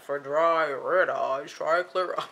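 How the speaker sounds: slow, loud mock-gloomy ad voice; melancholy deadpan. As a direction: drag it out like a melancholy pitchman. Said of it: "a melancholy kind of personality".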